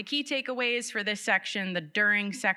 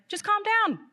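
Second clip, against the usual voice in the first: high, loud, snapping "Just calm down"; exasperated calm down; 14 semitones higher, 6 dB louder than usual. Retold or imitated. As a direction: bark it at someone freaking out, pitched way up. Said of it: "you're just like".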